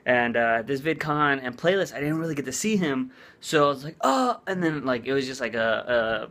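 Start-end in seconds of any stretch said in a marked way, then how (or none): 4.0-4.4 burst of excitement. Retold or imitated. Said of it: "a burst of excitement".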